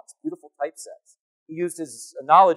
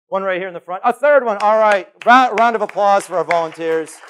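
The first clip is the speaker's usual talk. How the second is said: usual speaking voice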